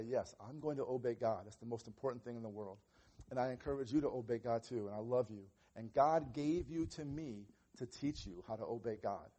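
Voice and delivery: quiet loving father voice; tender fatherly talk. Retold or imitated. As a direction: reassure the child gently. Tender talk to child before spanking.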